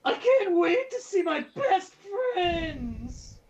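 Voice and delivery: very high piercing shrieky cry; shrill screech. Acted. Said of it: "So shrill".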